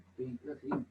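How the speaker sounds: very soft, discreet phone answer; hushed phone aside. Real, not acted. Answers a phone call mid-class. (contestando el teléfono en voz muy baja)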